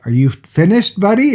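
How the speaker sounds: put-on high, loud sing-out question to a kid; retold call to child. Parents checking on the boy in the tent, high calling question. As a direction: call out brightly, pitch jumping up at the end.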